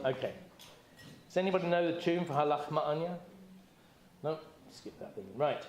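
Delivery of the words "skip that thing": whispered aside. Real, not acted. Muttered aside while leading.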